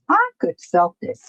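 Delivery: high-pitched, hopeful self-quote; mock eager realization. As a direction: act out the idea in a high little voice. Acting out her thought, repeated higher.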